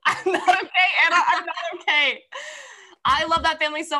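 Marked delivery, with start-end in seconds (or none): 0.0-2.2 high, loud laughing wail; laughing distress. Real, not acted. Telling friend the book has wrecked her, laughing wail.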